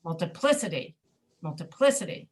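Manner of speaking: "multiplicity" with PLI punched, other syllables light; stress on PLI. Shown as a demonstration. Teacher showing where stress falls, "multiplicity" stressed on PLI. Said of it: "the stress is on pli".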